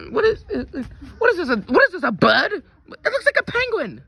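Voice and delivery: high, loud, incredulous questioning; baffled outcry. Acted. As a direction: blurt it out high in disbelief. Baffled by the bird.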